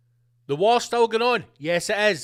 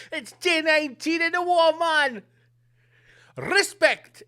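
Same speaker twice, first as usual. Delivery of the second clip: put-on laughing, higher-pitched Jamaican accent; mock Jamaican; 6 semitones higher than usual. Host turns a war bulletin into a joke, mock Jamaican. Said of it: "That's getting a bit Jamaican".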